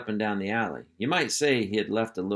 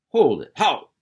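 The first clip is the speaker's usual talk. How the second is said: barked command; 6 semitones higher, 7 dB louder than usual. Acted. Hank halting the column.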